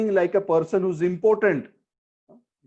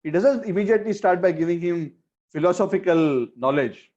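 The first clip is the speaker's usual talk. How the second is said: normal talking voice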